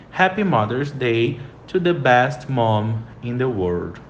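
the sentence said slowly a third time; slow model sentence. Shown as a demonstration. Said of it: "Terceira frase". (frase modelo lenta)

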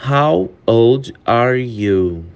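very slow, each word stretched; slow it down for learners; slow model question; slow demo. Shown as a demonstration. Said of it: "repeated at normal pace".

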